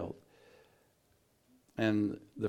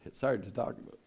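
a soft, rueful laugh through the words; laughing it off because it still hurts; pained laugh; 4 dB quieter, faster than usual. Real, not acted. To the listener, about a painful memory, a soft rueful laugh.

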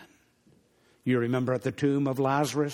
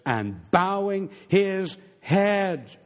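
slow, lifted reading of the verse; slow solemn reading; 6 semitones higher, 4 dB louder, much slower than usual. Shown as a demonstration. Preacher reading the verse slowly to the congregation, solemn. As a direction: draw out each word with reverence. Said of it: "We find it says".

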